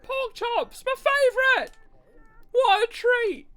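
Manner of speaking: high, loud cry of delight; delighted character. Acted. Game character shouts with joy over pork chops.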